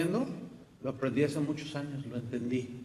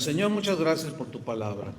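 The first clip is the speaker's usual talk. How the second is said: prayer; 4 semitones lower than usual. Real, not acted. Pastor leading the church in closing prayer, reverent. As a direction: speak to God humbly and steadily.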